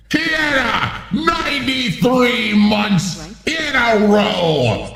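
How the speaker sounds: hammed-up pirate hail with drawn-out words; playful put-on pirate voice. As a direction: thank a shipmate like a rowdy pirate. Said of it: "read the joke like a pirate".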